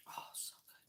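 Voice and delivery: delighted whisper. Real, not acted. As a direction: breathe it out in bliss. Whispered delight over food.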